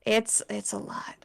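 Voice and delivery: hushed, breathy aside; whisper. Real, not acted. To friends, about her huge notes doc, whispered. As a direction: drop to a whisper, half sheepish.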